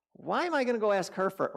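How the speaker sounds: high, quick objection; indignant self-talk. Retold or imitated. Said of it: "thinking to myself".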